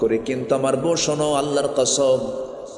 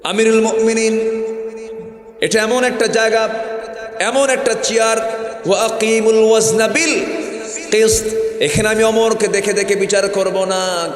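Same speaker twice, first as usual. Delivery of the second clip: solemn judge; 9 semitones higher, 3 dB louder than usual. Acted. The judge addressing the caliph from his chair, high and loud. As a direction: declare the law from the bench. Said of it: "কাজী চেয়ারে বসে বলতেছেন".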